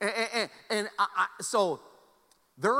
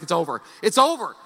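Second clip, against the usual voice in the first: panicked despair; 10 dB louder than usual. Retold or imitated. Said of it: "I'm like".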